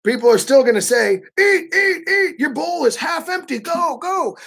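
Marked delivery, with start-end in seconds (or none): 1.4-4.4 much higher, insistent eat, eat; host urging a full guest to eat, much higher; pushy host. Retold or imitated. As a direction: nag the guest to keep eating. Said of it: "they'll even put food in your bowl".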